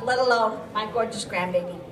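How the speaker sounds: normal talking voice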